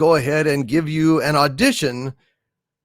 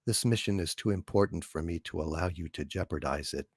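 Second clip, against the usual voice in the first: much lower and much softer, calm and even; HAL computer voice; 11 semitones lower, 15 dB quieter than usual. Acted. The ship computer answering astronaut Dave, low, calm and soft. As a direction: speak low, soft and unhurried like a polite machine. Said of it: "from two thousand and one, A Space Odyssey".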